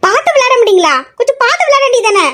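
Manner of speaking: sharp rise in pitch, reproachful questions; indignant scolding. Acted. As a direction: scold him in a high, upset voice. Second scolding of careless boy. (ஆத்திரத்தோடு உயர்ந்த குரலில் கண்டிப்பு)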